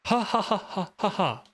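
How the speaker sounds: high mocking villain laugh ha ha; Mandark laugh. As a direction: cackle like Mandark. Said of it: "That's Mandark".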